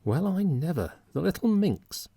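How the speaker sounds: high, indignant astonishment; shocked old lady exclaims; exclaiming. A narrator's reading. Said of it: "exclaimed Missus Stossman".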